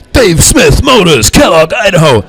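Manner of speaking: hammed-up local radio commercial. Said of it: "on the radio, you'll hear".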